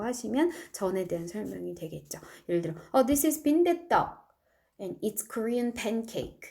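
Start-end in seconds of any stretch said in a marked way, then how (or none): 3.1-6.4 This is 빈대떡 said slowly, with 빈대떡 stressed; slow model sentence. Shown as a demonstration. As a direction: clear example for learners. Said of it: "이렇게 설명하시면 됩니다".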